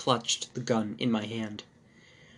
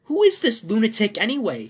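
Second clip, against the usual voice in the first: high, loud alarmed demand; alarmed question; 10 semitones higher, 8 dB louder than usual. A narrator's reading. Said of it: "he asked".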